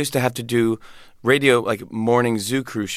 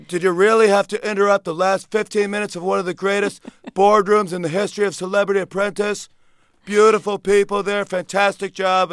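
Trump impression; 8 semitones higher than usual. Comic's Trump complaining to the president, impression voice. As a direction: puff up every superlative as Trump. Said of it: "I did a Donald Trump impression".

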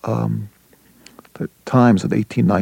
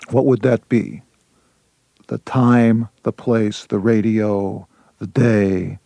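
improvised sonnet. Shown as a demonstration. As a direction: speak each line as a sonnet in the making. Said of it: "here I'm on my way to, to a sonnet".

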